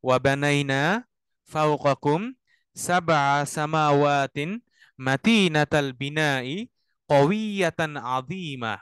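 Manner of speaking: very slow phrase-by-phrase chanted Arabic; recite to repeat. Shown as a demonstration. Teacher leads students to repeat after him. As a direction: chant each phrase slowly for students to echo. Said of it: "আমার সাথে সাথে আপনারা বলেন".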